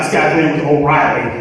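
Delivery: usual speaking voice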